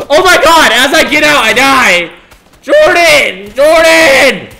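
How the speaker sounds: very high, very loud screams; screaming. Real, not acted. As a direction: scream in panic as you die. Screaming while dying in game.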